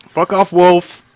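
loud high yell; angry shout. Real, not acted. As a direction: scream it at the wolf. Shouting at attacking wolf.

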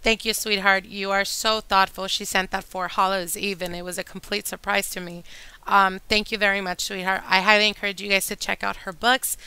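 ordinary talk, nothing marked

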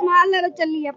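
crying. Real, not acted. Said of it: "ਰੋਣਾ ਨੀ".

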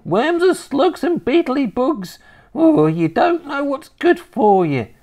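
high, loud grandma voice; gleefully nasty grandma. Acted. Grandma pushing caterpillars on her grandson, relishing.

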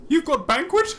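high, squeaky surprise; eager creature. Acted. Owen hearing of the banquet, high and eager. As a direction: perk up at the word banquet.

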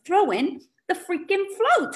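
ordinary delivery

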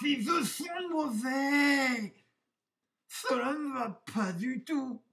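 put-on high wolf wail, exasperated; sighing wolf. A narrator's reading. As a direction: moan in despair at how awful you look. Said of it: "et soupira".

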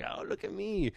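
high, soft mocking voice; mocking show-off. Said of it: "just be like".

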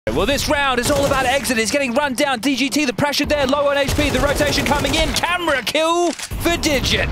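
high-pitched, full-throated live commentary; excited esports play-by-play. Real, not acted. Casting a round as the pressure mounts, excited play-by-play. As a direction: call the action at full pitch, stretching the final kill.

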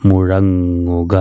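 each syllable drawn out slowly, joined into one word; slow blended reading. Shown as a demonstration. Teacher models the joined word slowly for madrasa pupils. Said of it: "ചേർത്ത് വായിക്കുമ്പോൾ എങ്ങനെ വായിക്കാം?".

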